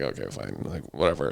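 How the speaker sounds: low, soft resigned mutter; quietly resigned, low and soft; grudging okay. Retold or imitated. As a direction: give in with a sigh. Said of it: "So we're sitting there like".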